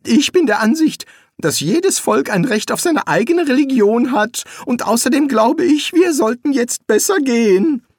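high-pitched, nervous wizard; hasty Rincewind. A narrator's reading. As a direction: hurry to excuse yourself, pitch up. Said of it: "begann Rincewind hastig".

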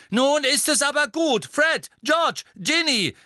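high, loud scolding shout; calling out sternly. A narrator's reading. Mother reining in her singing children, exasperated yell. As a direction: shout each name to stop them. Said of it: "rief Misses Weasley".

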